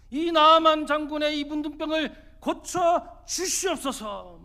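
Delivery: high, loud, slow pleading prayer; acted healing prayer. Healer as Naaman imagines him, praying over the leprosy, loud acted prayer. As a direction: pray it out forcefully, stretching the key words. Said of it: "하면서 기도해 줄 거라고".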